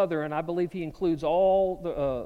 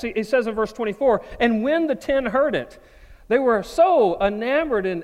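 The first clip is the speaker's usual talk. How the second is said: louder, driven pulpit preaching; preaching at full force; 3 semitones higher, 7 dB louder than usual. Acted. Preacher telling the disciples' story to the church, full-force preaching. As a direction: push each phrase out to the congregation.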